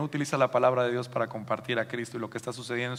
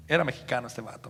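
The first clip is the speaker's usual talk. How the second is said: ordinary talk, nothing marked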